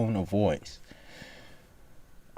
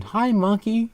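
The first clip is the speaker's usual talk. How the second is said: high greeting to monkey; 13 semitones higher than usual. Real, not acted.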